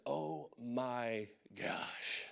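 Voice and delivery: hushed, drawn-out stunned dread; inward freak-out. Retold or imitated. Soldier turning away after Iraq news, privately panicking. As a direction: stretch each word slowly under your breath. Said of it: "internally freaking out".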